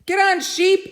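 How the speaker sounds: high, loud group call; shouted. Acted. The animals shouting at Sheep to climb on. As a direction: yell to the stubborn sheep. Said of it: "everyone shouted".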